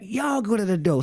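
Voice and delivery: high-pitched mock yell; retold yell back. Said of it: "And she yelled back".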